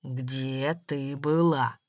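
low, very slow demand; insistent, menacing. A narrator's reading. Spiteful goblin insists on an answer, menacing. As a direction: press each word out low and slow. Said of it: "настаивал злобный гоблин".

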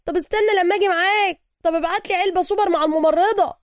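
calling after him. A narrator's reading. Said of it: "وسوكا ينادي عليه".

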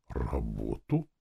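low, slow rising question; bewildered. A narrator's reading. Captain echoes the advice to find work, bewildered. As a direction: echo it slowly, at a loss. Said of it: "переспросил он растерянно".

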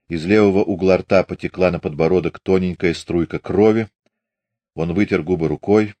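usual speaking voice

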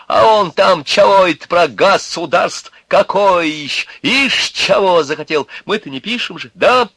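high-pitched sarcastic harangue; exultant mocking rant at full voice; loud, free yelling. A narrator's reading. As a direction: bawl bitter truths at passers-by. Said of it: "орал громко и вольно".

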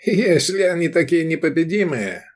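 high, sceptical scoff. A narrator's reading. Owner doubting the seller's boast, scoffing. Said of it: "усмехнулся Ланиста".

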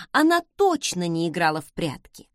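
usual speaking voice

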